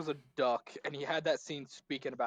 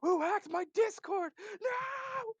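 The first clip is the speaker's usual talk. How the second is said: high, slow mock panicked shout; much slower than usual. Streamer retelling Discord scare, mock panicked shout. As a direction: cry out like you've been hacked. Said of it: "I was like".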